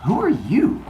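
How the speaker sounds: high, questioning challenge at the door; suspicious Cronus. Acted. Cronus to a stranger at his door, high and questioning.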